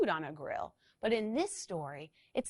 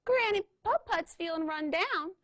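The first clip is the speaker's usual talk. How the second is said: high squeak of a little scooter; squeaky voice; 11 semitones higher, 4 dB louder than usual. Acted. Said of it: "squeaked Little Red".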